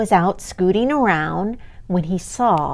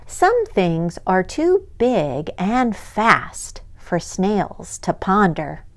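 ordinary delivery

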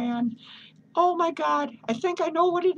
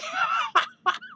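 squeaky high-pitched giggle. Real, not acted. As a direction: let a high giggle escape. Laughs at his own joke.

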